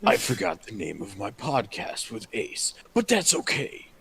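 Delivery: lightly done movie trailer guy. Pretend. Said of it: "movie trailer guy voices".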